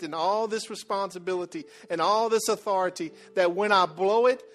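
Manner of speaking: preaching at full force. Acted. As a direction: preach it out to the congregation with rising fire.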